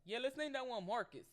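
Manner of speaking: high, loud mock-casual parent voice. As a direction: blurt it out as clueless parents. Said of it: "saw their son and said".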